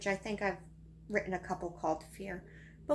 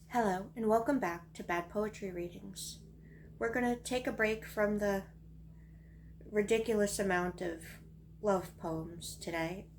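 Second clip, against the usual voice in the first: usual speaking voice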